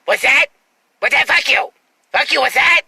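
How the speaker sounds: hammed-up rude guy; rude jerk voice. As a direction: bark it like a belligerent stranger. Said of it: "In that voice, though".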